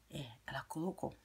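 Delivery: secretive little whisper. Real, not acted. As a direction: lean in and whisper it. Confiding aside about the belt.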